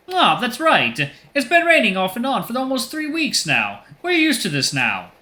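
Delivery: high, cartoonish Italian accent. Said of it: "stereotypical Italian voice".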